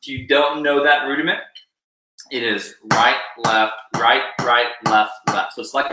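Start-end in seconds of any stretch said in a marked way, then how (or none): 2.9-5.5 evenly spaced, separated words; sticking call-out. Shown as a demonstration. Drum teacher breaks down the rudiment, rhythmic call-out. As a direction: call each stroke on the beat.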